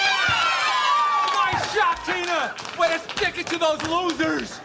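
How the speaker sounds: excited sideline cheering; shouting. Acted. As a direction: yell it across the court.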